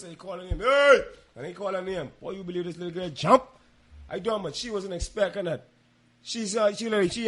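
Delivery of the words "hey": loud, raised fatherly "Hey!"; bass-voiced call. Father calls to a child who won't listen, commanding shout. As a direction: call out sharply to pull a child into line. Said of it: "he put one bass in my voice".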